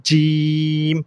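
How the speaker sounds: জিম drawn out long on a level note; demonstrated pronunciation. Shown as a demonstration. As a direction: hold the ee of জিম steadily before closing on m. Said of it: "এটা কি বলতে হবে?". (জিম লম্বা করে টেনে)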